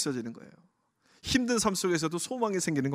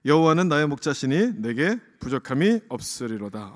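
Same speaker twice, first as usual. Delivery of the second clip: a louder recitation of the verse, with a lingering 부족함이; scripture read aloud together; 5 dB louder than usual. Real, not acted. Pastor reads the psalm with the congregation, in a louder recitation. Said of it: "일 절 말씀 우리 같이 한번 읽어볼까요".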